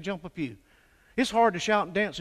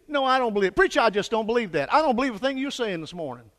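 mock stubborn doubter; 7 semitones higher, 8 dB louder than usual. Doubter answers preacher, acted.

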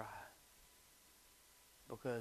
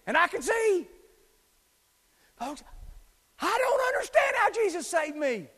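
loud, high, fervent pulpit preaching with shouted quotes; full-force preaching; 12 semitones higher, 14 dB louder than usual. Acted. Preacher to congregation, retelling the healed man, full-force preaching. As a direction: drive the gospel story home at full volume.

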